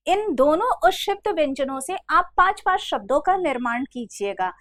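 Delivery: usual speaking voice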